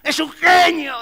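high, loud, slow gush of praise; admiring exclamation. Acted. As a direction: gush like an awestruck fan. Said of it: "uno allá en el fondo que dice".

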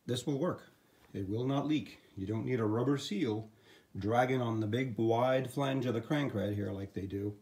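normal talking voice

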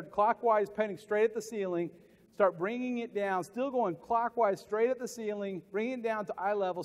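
walk the room through it step by step; guided instructions. Acted. Guiding the pen exercise.

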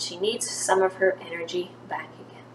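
soft, unvoiced caring whisper. Jesus telling the parents to feed her, whispered. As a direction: let it drop to a tender hush.

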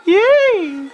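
high, loud squeal of joy; shrieking yay; excited cheer. Acted. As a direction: cheer like an overexcited fan. Excited cooking show cheer.

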